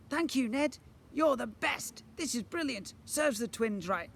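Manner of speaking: high, delighted cheering; excited gratitude. A narrator's reading. Children thanking the boy, excited high praise.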